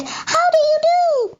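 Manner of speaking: lightly creepy twin creatures; Things' voice. A narrator's reading. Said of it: "They said".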